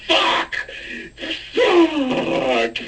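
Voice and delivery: drawn-out, high strained yell; mock yelling. Imagining his videos as nothing but swearing, yelling. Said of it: "my videos would be just me yelling".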